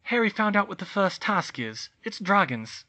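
put-on urgent girl voice. Hermione voiced, urgent news.

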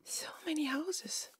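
hushed, whispered wonder; awed whisper. Real, not acted. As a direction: whisper it in amazement. Awed repeat at the village.

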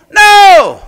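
sudden loud, high No; emphatic shout. Real, not acted. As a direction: slam the answer down with a shout. Preacher answers own question.

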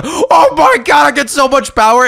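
high, slowed amazed exclamation; excited gasp. Real, not acted. To friends after going all the way down, amazed shout. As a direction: gasp it out in wonder. Huge power gain.

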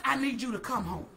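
low, soft, lightly voiced call from God; gentle voice of God. God calling Jeremiah to come home, low and soft.

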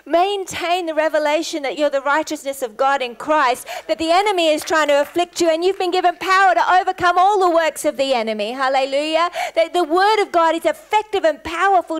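loud, high, impassioned preaching; full-force preaching. Real, not acted. Preacher driving the congregation to stand firm, full-force preaching.

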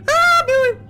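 shrill scream of Billy; terrified scream. Real, not acted. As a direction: shriek in fright. Monster appears.

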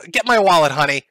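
loud, high, urgent demand; urgent fanboy voice. Pretend. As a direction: shout for the wallet in a rush. Acting a thirsty donating viewer.

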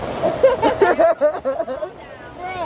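bright high burst of laughter; real amused laugh. Real, not acted. Laughing at friend's braids.

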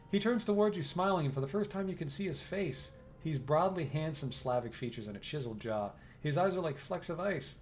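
steady, even storyteller read; game narration. A narrator's reading. Narrator sets the scene for the player, even storytelling. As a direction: read the room description like a narrator.

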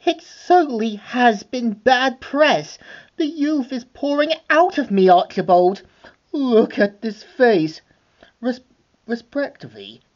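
preen like a vain villainess admiring her face; Cruella voice. Acted. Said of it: "we see her saying".

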